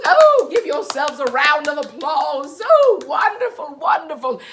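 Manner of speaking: loud, high, cheering; excited praise. Real, not acted. Praising children loudly.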